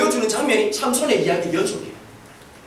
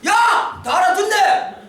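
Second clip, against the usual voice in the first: triumphant shout; 7 semitones higher, much slower than usual. Retold or imitated. Pastor catching teens who understood, triumphant shout. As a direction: call them out gleefully.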